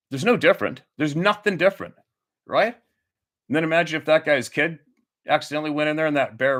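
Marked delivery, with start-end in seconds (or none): none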